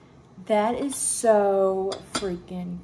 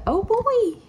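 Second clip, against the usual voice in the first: excited squeal; 13 semitones higher, 6 dB louder than usual. Real, not acted. Spots a new detail.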